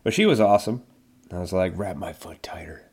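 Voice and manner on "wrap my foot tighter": hushed, voiceless whisper; soft whispered quote; retold whisper. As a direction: whisper the request under your breath. Retold request to the nurse.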